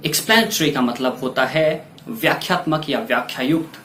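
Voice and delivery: ordinary talk, nothing marked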